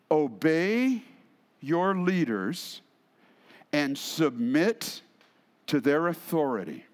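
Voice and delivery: slow scripture reading with long pauses. Shown as a demonstration. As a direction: read it out slowly, phrase by phrase. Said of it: "I'm gonna read that a little bit slower".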